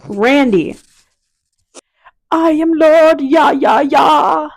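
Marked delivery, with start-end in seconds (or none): 2.3-4.5 slow, loud booming lord; grand proclamation. Pretend. Hammed-up character proclamation.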